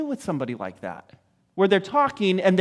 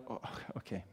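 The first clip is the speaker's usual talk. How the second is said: dismissive mumble; 9 semitones lower, 19 dB quieter, faster than usual. Retold or imitated. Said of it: "and you're like".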